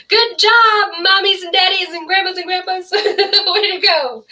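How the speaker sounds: upbeat motherese praise; cheerful praise. Shown as a demonstration. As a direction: cheer everyone on. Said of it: "my voice goes higher with babies".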